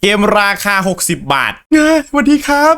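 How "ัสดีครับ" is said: lightly raised, chirpy penguin reading; penguin character voice. Acted. Penguin host greeting the new zookeeper, lightly raised voice. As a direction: voice the zoo's penguin host, a bit higher. Reads the game's penguin lines.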